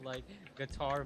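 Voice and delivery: normal talking voice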